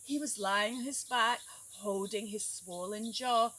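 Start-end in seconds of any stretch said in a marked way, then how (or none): none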